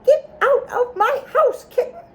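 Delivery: high, indignant little mouse; cross mouse. A narrator's reading. Mouse tells kitten to leave its house, high and cross. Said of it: "The mouse says".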